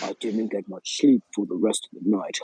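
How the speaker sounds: ordinary talk, nothing marked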